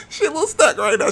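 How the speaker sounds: high, loud giggly aside; laughing. Real, not acted. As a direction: say it through laughter.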